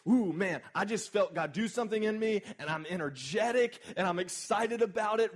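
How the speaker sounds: loud, high, gushing post-encounter excitement; excited hype. Retold or imitated. Preacher mimicking a churchgoer's rush, loud and excited. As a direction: play the worshipper buzzing with energy.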